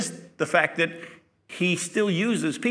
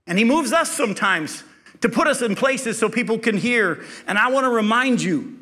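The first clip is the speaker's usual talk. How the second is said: preaching at full force; 7 semitones higher, 5 dB louder than usual. Acted. Preaching climax to congregation.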